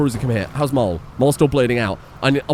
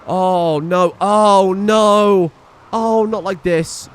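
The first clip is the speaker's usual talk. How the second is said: high wail growing louder; alarmed dismay; 6 semitones higher, 4 dB louder, much slower than usual. Real, not acted. Player seeing the raid arrive, dismayed. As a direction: cry out, louder on the repeat.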